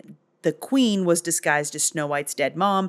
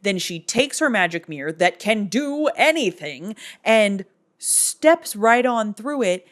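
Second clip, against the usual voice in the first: ordinary talk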